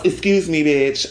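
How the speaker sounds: ordinary delivery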